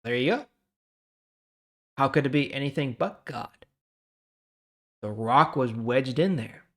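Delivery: usual speaking voice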